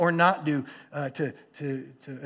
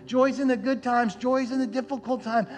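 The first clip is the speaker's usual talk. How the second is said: higher-pitched, rising sermon fervour; impassioned preaching; 5 semitones higher than usual. Real, not acted. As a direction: preach the promise with building conviction. Preaching scripture and its promise of joy.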